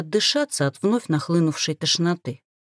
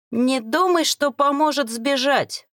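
higher-pitched stern warning; firm, raised-pitch caution; 7 semitones higher than usual. A narrator's reading. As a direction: warn a captive off. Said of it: "предупредил бородатый".

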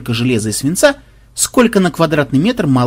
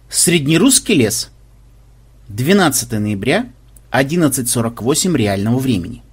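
ordinary delivery

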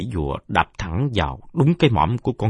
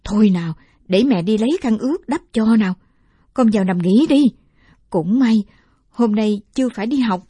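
much higher, fussing grandma; caring old woman; 14 semitones higher, 7 dB louder than usual. Acted. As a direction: comfort the boy like a doting grandmother. Grandmother soothes injured boy. (giọng bà cụ dỗ dành)

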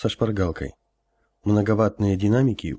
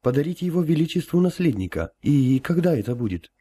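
nervous empress; 7 semitones higher than usual. Acted. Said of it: "справилась с волнением Алиса".

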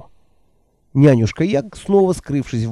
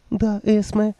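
put-on higher, timid old woman voice; meek old woman; 8 semitones higher, slower than usual. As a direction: answer meekly, eager to please. Nanny voiced by narrator.

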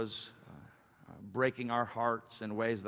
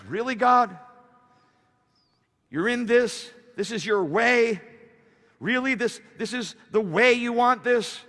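loud, high, disbelieving challenge; incredulous questioning; 11 semitones higher, 10 dB louder than usual. Acted. Preacher as David questioning God's way, incredulous. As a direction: press each question in raised-voice disbelief.

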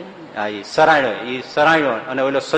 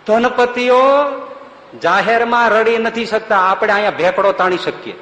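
lifted, louder preaching voice; preaching with force; raised voice; 9 semitones higher, 5 dB louder than usual. Real, not acted. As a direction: drive the point home loud. Preacher raising voice on point.

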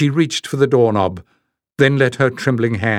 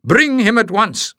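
roared demand; 10 semitones higher than usual. Acted. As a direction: bellow it at the whole room. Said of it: "the tall stranger roared".

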